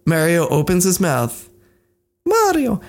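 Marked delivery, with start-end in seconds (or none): 2.3-2.8 high-pitched cartoon Italian accent; caricatured Italian accent, pitched way up. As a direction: squeak it in a hammy Italian accent. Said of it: "with a horrible Italian stereotype".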